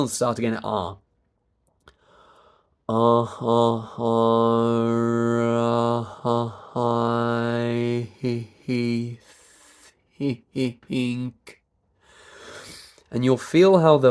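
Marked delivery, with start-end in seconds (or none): 2.9-11.6 pulsed vowels on a steady pitch; three-vowel chuckling. Shown as a demonstration. Coach restarting after a breath, three-vowel chuckling. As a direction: chuckle each vowel and stretch the last. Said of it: "continue on this three vowel chuckling".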